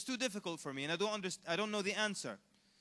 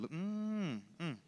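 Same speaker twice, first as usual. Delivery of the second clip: mock hungry "mmm"; much slower than usual. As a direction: hum as if eyeing a tasty meal. Mimes craving a dog as food.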